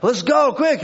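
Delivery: high, loud, hurried push to get going; rushing out the door in a hurry; hurried self. Retold or imitated. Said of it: "I'm like".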